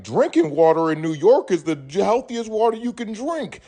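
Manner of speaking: high sing-song mock of a New Yorker. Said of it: "New York people will say".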